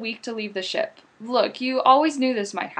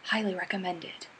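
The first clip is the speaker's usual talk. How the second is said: whispered tagline; 4 semitones lower, 9 dB quieter than usual. Acted.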